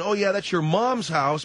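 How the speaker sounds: ordinary talk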